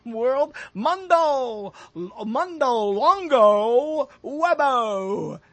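slow, drawn-out fake Italian words; mock Italian. Host mangling World Wide Web in fake Italian. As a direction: ham up a made-up Italian phrase, slowly. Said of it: "That's Italian for World Wide Web".

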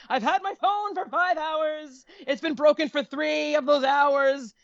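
desperate pleading. Retold or imitated. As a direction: beg the store guy, stretching the words. Frantic plea to store employee.

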